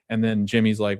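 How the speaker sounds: ordinary talk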